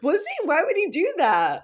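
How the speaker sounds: high, baffled mock voice; asking Patty in disbelief, high and innocent; naive confusion. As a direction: ask like you truly can't fathom it. Retold self naive confusion.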